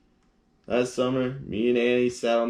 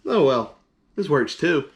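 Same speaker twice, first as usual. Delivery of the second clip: higher, louder, content; cheerful shrug; 5 semitones higher, 5 dB louder than usual. Acted. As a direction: make the best of it. Gerald accepts outcome.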